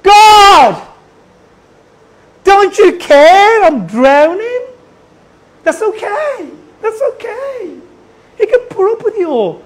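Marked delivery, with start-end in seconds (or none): 0.0-4.6 loud, high, drawn-out cry to God; desperate outburst. Acted. A frustrated believer cries out to God, desperate and loud. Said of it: "It'll be more like". 5.6-7.8 high-pitched, soft reassurance; reassuring. Shown as a demonstration. Preacher excuses an emotional prayer, reassuring and high. Reassures after the acted outburst.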